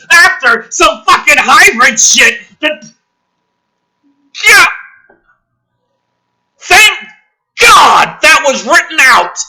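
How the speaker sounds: high, loud, disbelieving tirade; furious rant. Real, not acted. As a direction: yell at how stupid it is.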